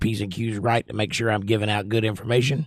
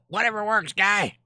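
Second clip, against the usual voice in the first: much higher, offhand little sidekick voice; little buddy's voice; 10 semitones higher than usual. Pretend. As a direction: squeak it like a tiny shrugging buddy. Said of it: "my little buddy down there going".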